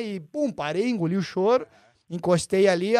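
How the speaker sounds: normal talking voice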